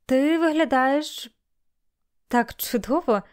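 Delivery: slow, drawn-out wonder; mild surprise. A narrator's reading. Bailey to Melanie on seeing her dressed up, mildly surprised. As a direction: linger over the words in light astonishment. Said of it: "У його голосі прозвучало легке здивування".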